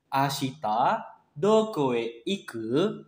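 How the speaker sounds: "Ashita doko e iku?" with the pitch rising on "iku"; slow casual question rising at the end; rising question tune. Shown as a demonstration. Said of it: "Nah, nadanya naik ya".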